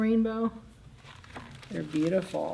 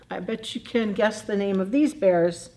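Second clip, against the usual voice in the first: plain reading voice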